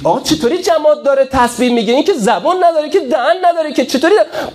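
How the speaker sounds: high, fast scoffing with a laugh; mocking the commentators. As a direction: parody the doubters' sneer. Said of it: "اینا اومدن گفتن".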